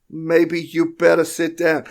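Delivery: loud, high, slow threat; menacing dame. Acted. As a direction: hold him at gunpoint, drawn out. The dame threatens Damien with gun.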